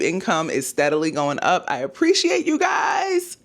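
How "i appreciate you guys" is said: high, slow, shouted gratitude; excited thanks. Real, not acted. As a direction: belt out a joyful thank-you. Thanking viewers, voice soars.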